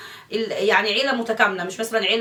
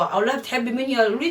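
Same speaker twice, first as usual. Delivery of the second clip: ordinary talk, nothing marked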